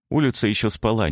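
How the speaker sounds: ordinary delivery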